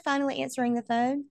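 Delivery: ordinary delivery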